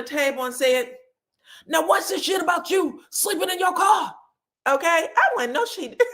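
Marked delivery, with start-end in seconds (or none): 1.7-4.2 confrontational shout. Retold or imitated. Mother calling out her son at dinner, confrontational shout. 5.5-6.0 high-pitched, breaking into laughter; laughing disbelief. Real, not acted. Reacting to Nell's outburst at the table, laughing disbelief.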